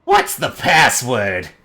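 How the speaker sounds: slow, loud demand; squinty-eyed gatekeeper's slow challenge; challenging fey guard. Acted. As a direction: draw the challenge out, loud and suspicious. Squinting creature challenges the party.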